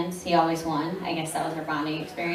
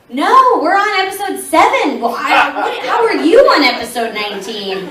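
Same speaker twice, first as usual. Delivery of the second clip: flustered loud exclaim; 9 semitones higher, 11 dB louder than usual. Retold or imitated. Fans are ahead on episodes.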